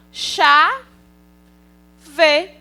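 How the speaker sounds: "chá" and "vê" isolated by a long pause; syllables said separately. Shown as a demonstration. Teacher showing children how syllables build a word, "chá" and "vê" said apart. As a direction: sound out "chá", wait, then "vê". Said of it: "Assim também como eu digo".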